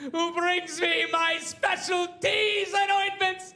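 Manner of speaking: host thanking crew and God, hammed-up; overwrought awards speech. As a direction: ham up the gushing thanks. Long mock awards speech impression.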